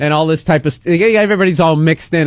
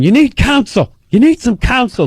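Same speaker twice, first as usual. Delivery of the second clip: higher, insistent dad voice; 6 semitones higher than usual. Retold or imitated. Dad urging his son to get a lawyer, insistent. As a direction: press the advice like a worried parent.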